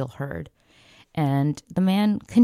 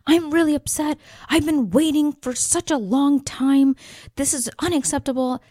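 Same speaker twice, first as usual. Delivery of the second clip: high, loud angry complaint; upset shouting; 11 semitones higher, 5 dB louder than usual. Retold or imitated. Upset man complaining to the manager about waiting, shouting. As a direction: raise your voice as a fed-up customer. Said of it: "he was still shouting".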